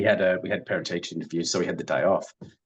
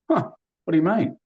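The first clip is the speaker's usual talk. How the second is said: retold surprise; 10 semitones higher, 4 dB louder, faster than usual. Retold shock at dad's demand.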